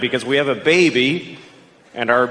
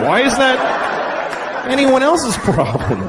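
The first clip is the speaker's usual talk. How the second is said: exasperated yell; 10 semitones higher, much slower than usual. Acted. Exasperated rant.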